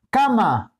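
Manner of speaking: cama with a high-pitched first syllable, ca-; stress on CA. Shown as a demonstration. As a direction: punch the CA in cama. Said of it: "acento en K". (cama, con acento agudo y marcado en ca)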